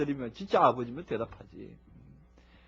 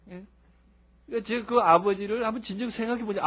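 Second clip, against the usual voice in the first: ordinary talk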